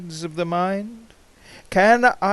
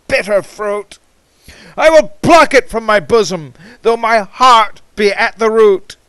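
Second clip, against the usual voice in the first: loud, fast, higher outburst; fierce resolve; 4 semitones higher, 8 dB louder than usual. Acted. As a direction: tear the words out fast and loud. Violent resolve in the poem.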